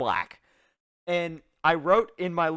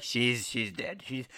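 low, slow villain drawl; 5 semitones lower, 6 dB quieter, slower than usual. Retold or imitated. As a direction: play it like a cartoon villain. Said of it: "He sounds like a classic villain".

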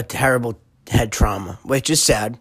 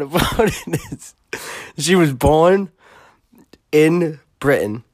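real laughing fit; 5 semitones higher, slower than usual. Real, not acted. Podcast host reaching the funniest part of a story, laughing helplessly. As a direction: laugh breaking into higher-pitched words. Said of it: "I'm crying right now laughing".

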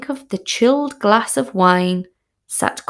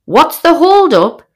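indignant retort; 8 semitones higher, 8 dB louder than usual. Retold or imitated. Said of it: "He says".

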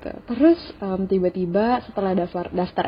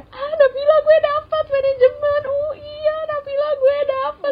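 high, mock-giddy friends showing off; excited friends bragging; 4 dB louder than usual. Friends at school gushing about their results, high and giddy. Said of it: "temen-temen gue pada".

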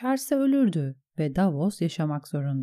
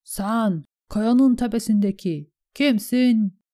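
raised, high challenge from a boat; calling out; 6 semitones higher, 5 dB louder, slower than usual. A narrator's reading. Boatman asks the man on the rock who he is, calling out. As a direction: hail a stranger, building to the question. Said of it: "diye seslendi beşinci adam".

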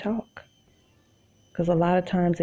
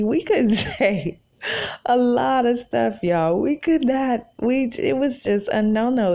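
laughing emphasis; 7 semitones higher than usual. Real, not acted.

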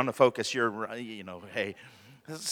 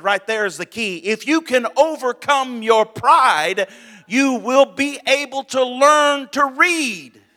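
rising from firm to a loud, high, slow shout; climbing pulpit cry; preaching build to a shout; 10 semitones higher, 9 dB louder than usual. Acted. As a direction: build the sermon to a roar.

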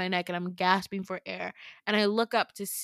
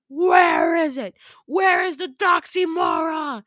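slow, much louder, higher roaring monster demand; 8 semitones higher, 10 dB louder, slower than usual. Retold or imitated. Monster choking her demands the Doxymora, roaring. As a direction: bellow it like a beast interrogating prey. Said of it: "he, like, roars".

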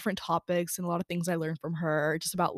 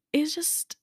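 breathy whispered hesitation; whispered false start; 8 semitones higher than usual. Real, not acted. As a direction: barely voice the words, hesitating.

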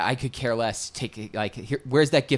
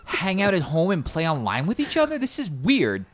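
higher-pitched imitation of a baffled newcomer; incredulous questions in a pitched-up mock voice; mock bewilderment; 7 semitones higher than usual. Friend retelling her first time playing online, mock bewilderment. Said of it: "She's like".

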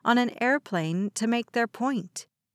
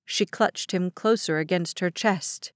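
ordinary delivery

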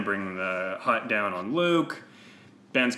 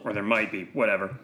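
usual speaking voice

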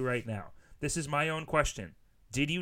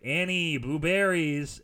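mock calling out; 7 dB louder, slower than usual. Friends offering blueberries to Annie.

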